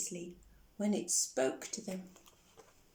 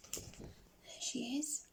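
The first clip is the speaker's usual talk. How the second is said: soft, slow whisper; 12 dB quieter, much slower than usual. Acted. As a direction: whisper as if pointing it out. Narrator aside to children.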